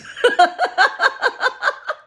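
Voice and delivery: high-pitched burst of laughter; real high giggling fit; helpless laugh. Real, not acted. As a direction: crack up at your own joke. Laughing at her own coat joke.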